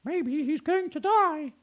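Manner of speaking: very high, chirpy bird voice; high twittering blackbird; chirping blackbird. Acted. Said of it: "chirped a blackbird".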